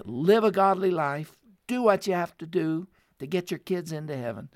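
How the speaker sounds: rally the women like a preacher; preaching exhortation. Acted. Urgent exhortation to women listeners.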